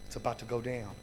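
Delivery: hushed worried thought; inner thought. Retold or imitated. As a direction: say it as a thought in your head. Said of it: "I'm thinking to myself".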